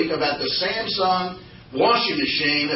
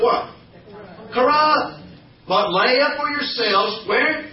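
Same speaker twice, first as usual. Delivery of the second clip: higher, prompting the congregation; call and response; 5 semitones higher, slower than usual. Shown as a demonstration. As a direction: lift the pitch to coax the answer. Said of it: "prompting congregation call-and-response".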